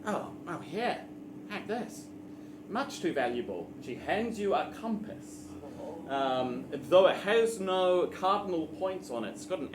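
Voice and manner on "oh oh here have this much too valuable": hushed goblin. Acted. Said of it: "She goes".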